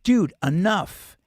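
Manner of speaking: loud, slow, firm "Dude, enough"; firm warning. Retold or imitated. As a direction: lay down the law, slow and loud. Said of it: "And I said".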